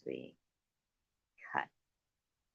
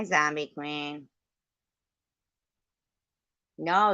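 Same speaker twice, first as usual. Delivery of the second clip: ordinary delivery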